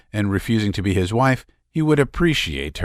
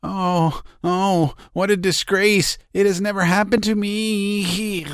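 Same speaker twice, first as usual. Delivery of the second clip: tearful hysterics; 11 semitones higher than usual. A narrator's reading. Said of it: "feeling the warmth of tears".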